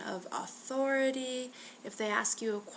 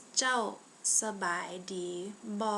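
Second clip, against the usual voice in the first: slow, pausing between each word; slower for tones; much faster than usual. Shown as a demonstration. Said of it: "a little bit slower so you can hear the tone".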